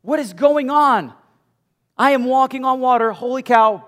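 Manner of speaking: high, loud, hammed-up panic; panicked Peter. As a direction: yell in mock alarm, pitch way up. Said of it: "suddenly starts to kind of look around like".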